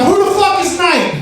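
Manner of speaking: high-pitched, hammed-up fighting talk; mock belligerence. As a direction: pick a drunken fight, starting high and dropping by the end. Acts out drunk belligerent challenge.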